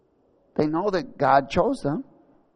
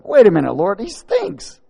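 high, comic protest stretched at the end; alarmed sister; 7 semitones higher, 7 dB louder than usual. Acted. Sister stopping Jesus at the tomb, high alarmed protest. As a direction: blurt it loudly, then drag out the last word. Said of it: "one of the sisters said".

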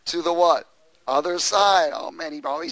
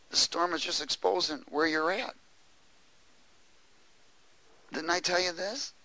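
calm rebuke; 6 dB quieter than usual. Retold or imitated. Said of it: "He says".

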